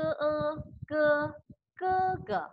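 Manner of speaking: ke said high, repeated to show the tone; tone demo. Shown as a demonstration. Teacher drills the tone of ke for students. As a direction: sing out ke with the Mandarin tone. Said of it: "Jadi ini ke".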